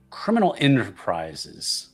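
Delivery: ordinary delivery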